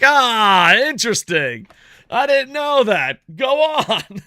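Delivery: high, loud mock amazement; fake interest. To his wife, mocking agreement with a loud fake awe. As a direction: act fascinated, hamming it up. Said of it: "I'm like".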